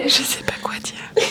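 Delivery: slow whisper, right in your ear; ASMR whisper. Pretend. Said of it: "je vous fais de l'A S M R".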